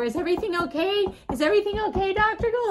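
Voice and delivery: high, loud mock concern; urgent high-pitched checking in, comically retold; alarmed nurses. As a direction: imitate nurses calling anxiously through a door. Worried nurses knocking, retold.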